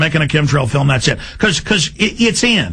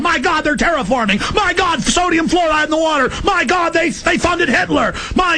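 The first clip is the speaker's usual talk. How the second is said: high, near-shouted mock panic; high-pitched alarmed yelling; freaked-out ranting; 9 semitones higher than usual. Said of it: "I'm going".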